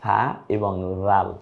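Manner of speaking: slow, drawn-out answer to the quiz. Shown as a demonstration. Teacher giving the quiz answer, slow and deliberate. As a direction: spell out the answer for the class. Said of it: "কি কি হবে?".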